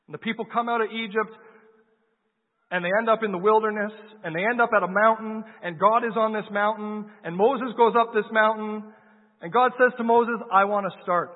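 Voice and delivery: preaching at full force. Acted. Preacher telling the Exodus to the congregation, full-force preaching. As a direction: drive the story home to the congregation.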